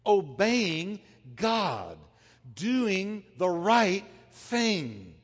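very slow, weighty preacher emphasis. Acted. Preacher slowing right down to stress obeying God, slow. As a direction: let each word land slowly.

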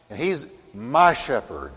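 normal talking voice

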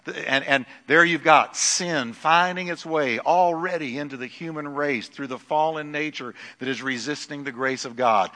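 normal talking voice

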